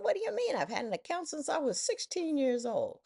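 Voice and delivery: high, astonished protest; indignant disbelief. Retold or imitated. Indignant retort in story.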